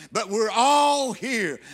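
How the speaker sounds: all stretched long in a preacher's shout; stress on all. Acted. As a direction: hold and punch the word all. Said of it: "underline that word all".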